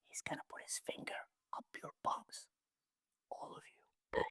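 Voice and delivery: unvoiced whisper, close in the ear. Trainee whispering a warning into a Navy seal's ear. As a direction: breathe it as a secret warning. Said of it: "I whispered in his ears".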